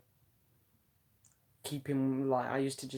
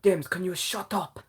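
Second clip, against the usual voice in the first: higher, slower mock French teacher; bad French accent; 5 semitones higher than usual. French teacher tells pupil off in class, bad French accent. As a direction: scold in a hammed-up French accent. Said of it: "do an impression of her".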